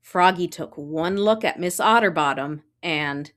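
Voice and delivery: ordinary delivery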